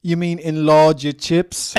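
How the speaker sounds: loud, slow incredulous question; incredulous Brit. Retold or imitated. Jason Statham baffled by a supersize order.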